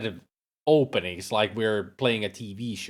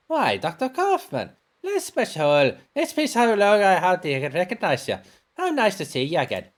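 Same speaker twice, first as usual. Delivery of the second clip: higher-pitched housekeeper, pleasantly surprised; delighted housekeeper; 10 semitones higher than usual. Acted. Housekeeper greeting a long-absent doctor, delighted and high. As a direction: greet an old acquaintance in a high matronly voice.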